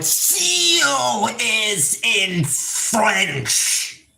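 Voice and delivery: high, drawn-out SEAL and FRENCH; exasperated demand. Real, not acted. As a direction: stretch the words out in exasperation.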